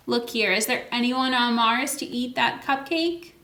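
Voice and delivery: ordinary delivery, nothing marked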